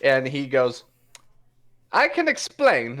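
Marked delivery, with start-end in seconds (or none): 1.9-3.0 sheepish excuse. Acted. Caught cheating.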